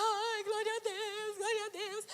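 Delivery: high, drawn-out mock crying; imitated weepy high voice; tearful praise. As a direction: wail thanks to God in mock sobs. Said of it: "Comecei a chorar".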